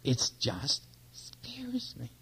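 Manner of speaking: slow, voiceless whisper; hushed scared confession; frightened whisper. Retold or imitated. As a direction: whisper the fear slowly. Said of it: "And I said".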